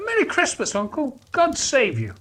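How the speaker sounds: high, bright-hearted call to his uncle; cheerful greeting. A narrator's reading. Nephew bursting into the office with cheer. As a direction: greet him with big holiday cheer. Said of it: "Fred said".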